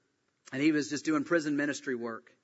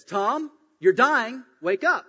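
louder, higher, drawn-out firm call; firm raised voice; 9 semitones higher, 9 dB louder, slower than usual. Acted. Bill tells Tom on the floor to wake, firm raised voice. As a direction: firmly call a man to wake up. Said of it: "speak a little bit more firm".

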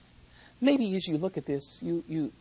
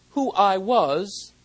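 slow; 3 semitones higher, 8 dB louder, much slower than usual. Shown as a demonstration. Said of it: "paraphrase that name ... like this".

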